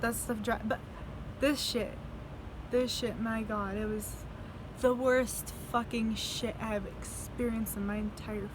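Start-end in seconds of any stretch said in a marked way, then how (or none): none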